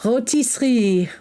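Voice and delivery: put-on playful French accent on "Rotisserie", on request. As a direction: say "Rotisserie" the French way, dropped R, "oh-tee-see". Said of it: "say rotisserie with a French accent".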